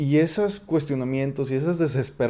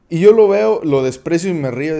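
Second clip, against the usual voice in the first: scornful outburst; 3 semitones higher, 9 dB louder than usual. Acted. Acting out scornful son.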